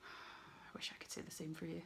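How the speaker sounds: a creepy unvoiced whisper. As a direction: breathe it like a ghost story threat. Spooky aside to the listener.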